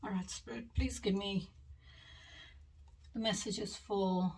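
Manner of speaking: breathing hard with a cold; heavy breaths through a head cold; audible breathing. Real, not acted. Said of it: "hear me breathing a little extra hard".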